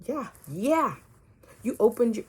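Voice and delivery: a loud, insistent spirit voice confirming. Retold or imitated. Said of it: "Spirit was like".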